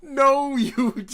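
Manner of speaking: loud, high laughing exclamation; cracking up in disbelief. Real, not acted. Laughing reaction to movie scene.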